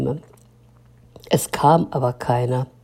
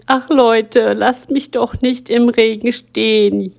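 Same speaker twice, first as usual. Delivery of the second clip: high, louder wail of complaint; a soaked story lamenting aloud; whining story; 7 semitones higher, 5 dB louder than usual. Acted. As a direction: whine to the passers-by. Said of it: "jammerte die Geschichte".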